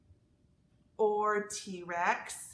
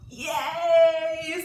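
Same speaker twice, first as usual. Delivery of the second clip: cheering; 5 semitones higher, 7 dB louder than usual. A narrator's reading. Children cheer in story.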